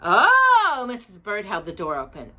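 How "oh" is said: high, loud startled cry. A narrator's reading. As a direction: jump at the sight of a bear. Mrs Bird startled at bear.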